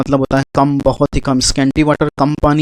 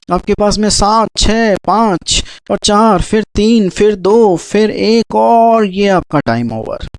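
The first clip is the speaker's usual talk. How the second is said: higher, slowly stretched countdown; quiz countdown; 5 semitones higher, slower than usual. Acted. Quiz teacher counting the last seconds for viewers, drawn out. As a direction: build tension on each number like a game-show host.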